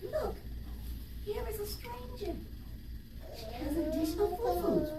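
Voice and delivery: high, soft tinkling fairy. Acted. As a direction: chime it like a tiny fairy. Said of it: "cried in a tinkling voice".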